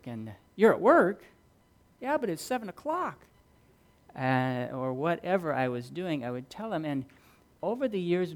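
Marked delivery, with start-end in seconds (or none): 0.6-3.2 mildly acted surprise, pitched higher; mock incredulous. Preacher voicing his friend hearing he's at work, mock incredulous. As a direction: question it high, as a baffled friend.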